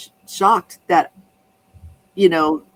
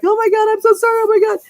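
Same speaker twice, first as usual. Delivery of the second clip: much higher and louder, frantic mock apology; flipped-out apology; 5 dB louder, faster than usual. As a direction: squeal out the sorry in panic. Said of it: "the guy was flipped out".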